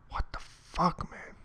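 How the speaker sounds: whispered exasperated curse; frustrated mutter. Real, not acted. Coder cursing quietly at broken code, whispered. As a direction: breathe it out under your breath. Code not working, angry.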